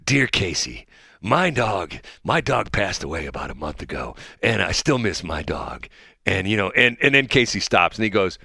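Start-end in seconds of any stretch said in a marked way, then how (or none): 0.0-5.9 sad dedication letter. Retold or imitated. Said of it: "he did the letters to Casey".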